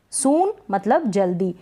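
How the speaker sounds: ordinary talk, nothing marked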